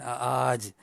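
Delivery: "aj" with a long AA, as "aaaj"; lengthened alif. Shown as a demonstration. Teacher telling students to lengthen it, drawn-out "aj". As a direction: draw out the vowel of "aj". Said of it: "Panjangkan aj tu".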